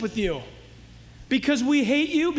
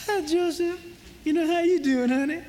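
sing-song seductive greeting, a bit higher; flirty temptress voice; 5 semitones higher than usual. Retold or imitated. Preacher mimicking Potiphar's wife flirting with Joseph. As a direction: flutter the words like a flirt. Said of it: "I don't think she talked like that".

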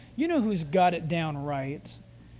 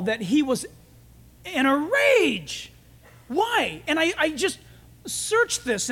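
higher, building sermon voice; rising preaching; 4 semitones higher than usual. Acted. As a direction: preach it with growing fire. Preaching builds on Saul's rage.

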